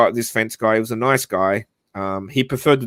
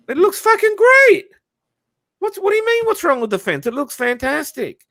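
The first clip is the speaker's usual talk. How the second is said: high, loud, incredulous protest; incredulous outburst; 4 dB louder than usual. Retold or imitated. To mum about the fence, a high indignant outburst. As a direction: squeal it out in disbelief.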